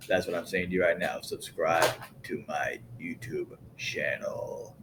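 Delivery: low hushed sales pitch, slowing down; low, soft plug. Pretend. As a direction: drop the voice low and draw it out. Plugs channel in a low voice.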